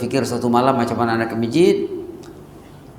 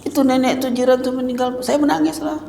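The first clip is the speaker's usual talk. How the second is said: put-on high, earnest little boy explaining; child's voice; 11 semitones higher, 3 dB quieter than usual. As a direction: plead simply like a small child. Child answers father.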